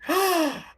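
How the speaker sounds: shocked gasp. Acted. Rory shocked at news.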